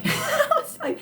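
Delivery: very high laughter breaking through; laughing. Real, not acted. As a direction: crack up mid-sentence. Laughing at dad's remark.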